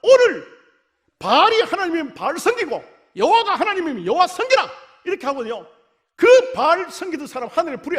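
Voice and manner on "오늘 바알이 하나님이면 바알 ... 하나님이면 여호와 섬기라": loud, high commanding cry; prophet's shout. Retold or imitated. Elijah commands the people to choose, a loud high cry. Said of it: "그리고 그들을 향해서".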